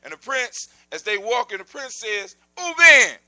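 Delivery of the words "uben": loud, high, falling call; sudden storyteller's shout to get attention. Acted. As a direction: holler the companion's name. Prince calls out to his companion.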